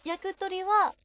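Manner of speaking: title said in Kansai dialect intonation; Kansai accent. Shown as a demonstration. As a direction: ask it with a countryside Kansai lilt. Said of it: "関西弁ですか、これ？". (関西弁のイントネーションで)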